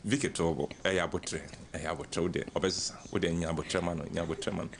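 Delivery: put-on calm her daughter down like an old mother; soothing mother. Mother telling her upset daughter to be patient, low and soft. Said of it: "the mother, typical mother will say".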